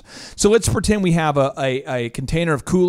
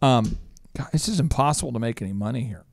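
discouraged friend; 4 semitones lower, 6 dB quieter than usual. Retold or imitated. Struggling Minnesota builder telling host he can't profit, glum. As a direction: sigh out the complaint. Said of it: "He's like".

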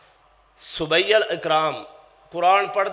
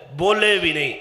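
usual speaking voice

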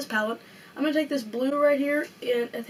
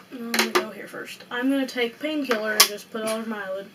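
ordinary talk, nothing marked

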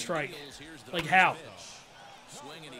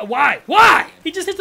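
each why higher and louder; rising outcry; 6 semitones higher, 11 dB louder, slower than usual. Real, not acted. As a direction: build to a pained shout. Weak grounder becomes hit.

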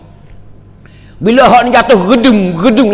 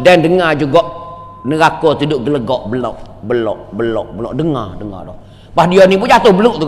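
ordinary delivery

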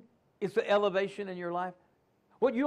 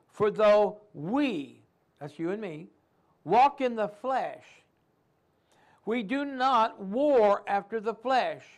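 slow, weighty reading of the verse; solemn preacher reading; scripture reading; 3 dB louder, slower than usual. Acted. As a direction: lay each word of scripture down slowly.

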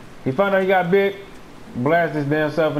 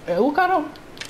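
puzzled; 9 semitones higher, 3 dB quieter, slower than usual. Real, not acted. Drawn-out puzzled retake.

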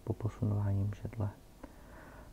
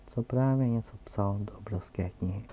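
ordinary delivery

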